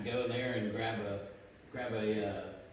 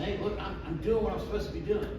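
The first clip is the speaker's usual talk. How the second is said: higher, quicker boasting; mock self-righteous; 6 semitones higher, faster than usual. Self-righteous man bragging to God, mock boastful. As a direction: play a mildly smug man justifying himself. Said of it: "that level of arrogance".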